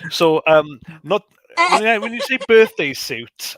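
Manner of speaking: high, loud laughing words; laughing through the words. Real, not acted. Cracks up at birthday suit.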